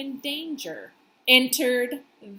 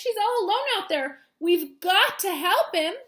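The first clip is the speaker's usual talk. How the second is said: higher and louder, pleading; urgent worry; 7 semitones higher, 6 dB louder than usual. Acted. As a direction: urge the friends to act now. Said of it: "said Rainbow Fish".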